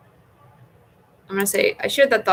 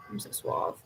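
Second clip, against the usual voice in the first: whispered joke; 9 dB quieter than usual. Real, not acted. Jokey reply to game prompt.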